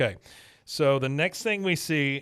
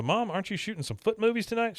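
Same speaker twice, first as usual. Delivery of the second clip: put-on high, quick kid voice; lightly voiced boy; Bobby voice; 9 semitones higher, faster than usual. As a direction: innocent son asking. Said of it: "He goes".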